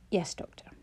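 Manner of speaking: whispered, worn-out mock obedience; a limp, breathy yes to the doctor; tired whisper. A narrator's reading. Sick Clara salutes Jonah limply, whispering tiredly.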